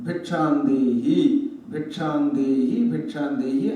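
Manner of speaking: low, drawn-out call made in measured repeats; low monk's call with a lift on each ending; mendicant's repeated alms call. Acted. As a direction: call slowly for alms, pausing between calls. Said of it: "మూడే మూడు సార్లు".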